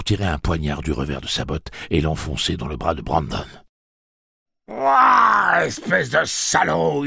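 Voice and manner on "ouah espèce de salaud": very high, loud, startled scream; howl of pain. A narrator's reading. Brandon stabbed in the arm, howling in surprise. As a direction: scream the insult in shock and pain. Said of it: "hurla celui-ci sous l'effet de la surprise et de la souffrance".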